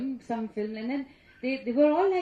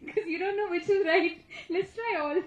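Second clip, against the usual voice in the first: higher-pitched, laughing through the words; amused at yourself, giggling as you talk; 7 semitones higher than usual. Real, not acted. Confessing her spelling guesses, laughing.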